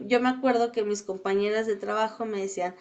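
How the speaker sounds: normal talking voice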